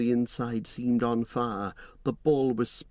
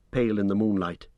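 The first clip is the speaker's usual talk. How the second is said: ordinary delivery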